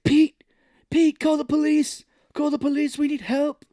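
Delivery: high, loud mock panic shouting; frantic phone shout. Cage calling his friend for help, frantic high shouting. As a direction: ham up a desperate shout down the phone. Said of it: "He's like".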